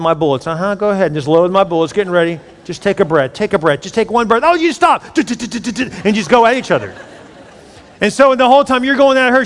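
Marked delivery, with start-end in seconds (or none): 4.4-5.0 high, loud mock snap at a spouse. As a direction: fire it back in a hammed-up argument. Mock argument with wife.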